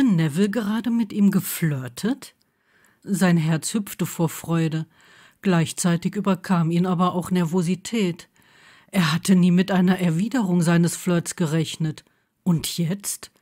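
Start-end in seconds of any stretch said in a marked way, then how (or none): none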